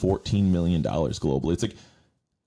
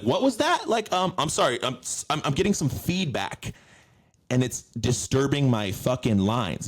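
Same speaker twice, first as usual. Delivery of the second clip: fussy robot actor; 6 semitones higher than usual. Retold or imitated.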